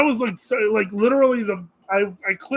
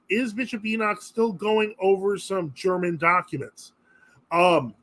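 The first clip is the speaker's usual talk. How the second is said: ordinary talk, nothing marked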